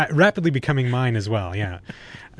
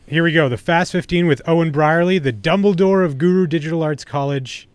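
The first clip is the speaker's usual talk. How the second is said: upbeat host announcing the segment, louder and higher; hyped segment intro for the guest; show host intro; 4 semitones higher, 5 dB louder than usual. Acted. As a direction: kick off the quiz like a radio host.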